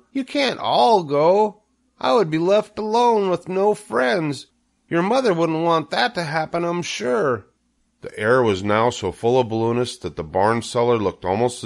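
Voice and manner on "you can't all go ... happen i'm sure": high, insistent plea; Wilbur the pig, pleading. A narrator's reading. Wilbur fears being left alone, pleading high. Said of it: "asked Wilbur".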